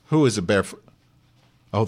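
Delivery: ordinary talk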